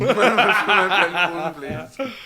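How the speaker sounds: slow, laughing echo in a mock German accent; mimicked German accent. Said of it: "Fucking accent police".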